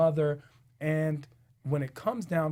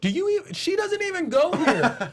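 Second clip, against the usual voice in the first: high mocking quote; quoting Mean Girls, higher and mocking; 11 semitones higher than usual. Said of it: "the scene in Mean Girls where they're like".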